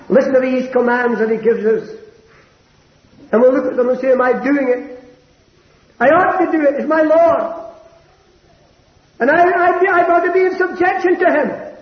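pitched high and ringing, a fervent pulpit cry; preaching at full force. Acted. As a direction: lift the whole confession up high like a sermon climax. Preacher voices the believer's vow.

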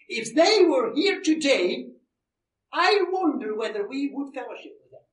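high, loud preacher's urging; impassioned exhortation. Real, not acted. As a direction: urge the congregation forcefully. Exhorts the congregation.

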